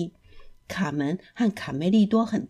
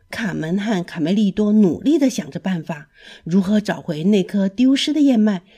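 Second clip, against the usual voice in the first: ordinary delivery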